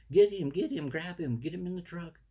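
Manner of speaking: high, frantic mock squabbling; bickering neighbors. Neighbors loading chihuahuas in the truck, mock bickering. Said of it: "they're bickering amongst each other".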